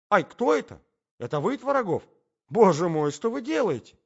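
high, loud alarmed lady; startled. Acted. Lady is seized by Tvorogov on the stairs, startled. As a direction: jump up high in startled protest.

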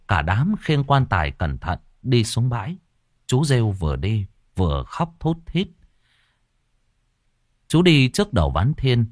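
ordinary delivery, nothing marked

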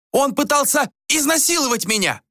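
high-pitched, accusing yell; woman's shouted accusation; crying out. A narrator's reading. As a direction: cry out the accusation in outrage. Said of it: "закричала Ванора".

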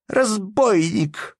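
high, loud, drawn-out shriek; wailing scream. A narrator's reading. Wife screaming at husband from the quarrel, wailing. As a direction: wail the accusation at him. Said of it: "завопила Анна Андреевна".

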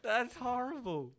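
high, slow, through laughter; laughing. Real, not acted. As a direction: crack up at a terrible attempt. Laughing at friend's bad accent.

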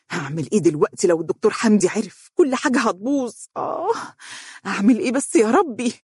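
fretful and rattled, with a high groan; nervous, agitated. A narrator's reading. Dunya alone after hanging up, nervous and agitated. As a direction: fret aloud at being found out. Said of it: "وقالت بعصبية".

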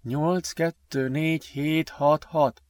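hushed recital while keying in; murmuring. A narrator's reading. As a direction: mumble the numbers under your breath. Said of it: "mormolta az orr alatt Ben".

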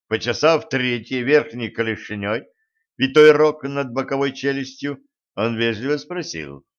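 ordinary delivery

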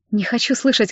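plain reading voice

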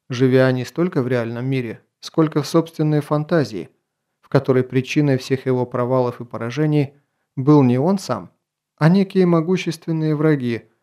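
plain reading voice

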